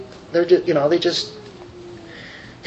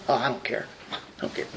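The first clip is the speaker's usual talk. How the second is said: softer, fading to a breathy whisper; mock indifference; 7 semitones lower, 14 dB quieter, much faster than usual. Preacher mocking an uncaring Jesus, trailing into a whisper. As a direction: toss it off, dropping to a whisper.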